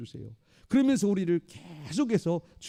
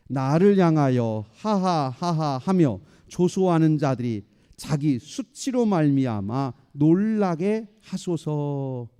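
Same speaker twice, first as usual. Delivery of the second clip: loud, slow, measured verse reading; scripture reading; 5 dB louder, slower than usual. Acted. Preacher leading the congregation in reading verse fifteen, slow loud recitation. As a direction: read it out slowly and forcefully for everyone.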